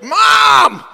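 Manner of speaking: high, loud yell held long; fevered shout. Retold or imitated. Calling to a mother-in-law who can't hear, fevered shout. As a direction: holler it at fever pitch. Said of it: "like, a fevered pitch".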